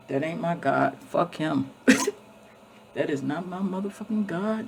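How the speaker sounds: ordinary delivery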